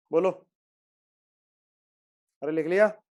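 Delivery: usual speaking voice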